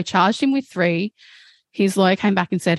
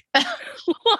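high real laugh. Real, not acted. Laughing at absurd lawyer remark.

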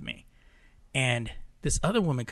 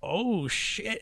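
higher, drawn-out stunned reaction; mock shock; 7 semitones higher, 4 dB quieter than usual. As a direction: imitate a bystander's amazement. Teller's retold shocked reaction.

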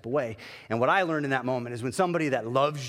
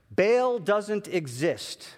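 each word drawn out slowly; slow revelation; 6 semitones higher, 3 dB louder, much slower than usual. Retold or imitated. God revealing the truth to the pastor, drawn out slowly. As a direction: lay it down slowly for effect.

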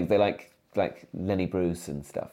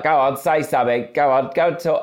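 mum's coaxing voice, louder and higher; mum urging; 7 semitones higher, 8 dB louder than usual. Retold or imitated. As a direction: egg the child on like an eager mum. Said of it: "And mum would be like".

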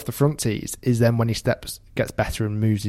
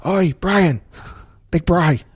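raised higher, calling out across the course; a lightly acted shout at a golfer; mock shout across fairways; 7 semitones higher than usual. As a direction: yell it over to him from three fairways away. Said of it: "shout across three fairways to him".

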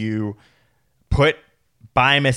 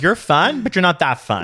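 high teasing brush-off; 7 semitones higher, 4 dB louder than usual. Retold or imitated. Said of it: "Be like".

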